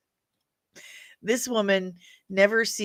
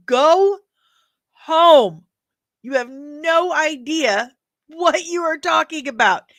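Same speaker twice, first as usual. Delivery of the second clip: loud, high exasperated outburst; exasperated telling-off; 7 semitones higher, 7 dB louder than usual. Real, not acted. Vlogger telling a critic she is clueless, exasperated telling-off. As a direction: raise your voice and send them packing.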